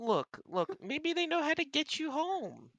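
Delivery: very high gentle cat voice; comforting cat. Acted. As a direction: coax him like a kind kitten. Rita comforts Aaron.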